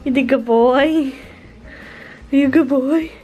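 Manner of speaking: talking to a dog. Real, not acted. Praising the dog.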